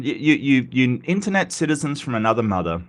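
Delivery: ordinary talk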